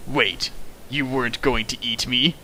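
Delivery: usual speaking voice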